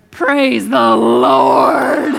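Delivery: slow, loud, laughing through it; laughing relief. Retold or imitated. Rider thanking God at the cliff edge, slow and laughing. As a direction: stretch out the punchline with a laugh.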